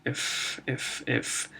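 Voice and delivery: F hissed alone, no voice; breathy F sound; whispered F sound. Shown as a demonstration. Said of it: "This is an F".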